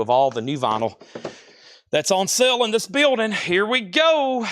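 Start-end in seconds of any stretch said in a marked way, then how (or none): 1.9-4.5 hyped-up announcement. Real, not acted. Seller kicking off the vinyl on sale, hyped-up. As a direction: pitch it high, stretch the final words.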